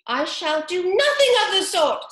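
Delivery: high, loud indignant squeak; offended mouse. A narrator's reading. Mouse storming off from Alice, indignant squeak. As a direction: huff it out as an insulted mouse.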